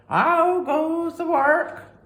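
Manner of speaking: high, slow, loud lazy farmer call. Acted. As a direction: call down from bed, drawn out. Farmer calls again.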